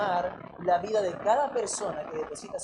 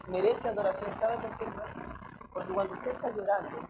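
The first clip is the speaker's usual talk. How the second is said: usual speaking voice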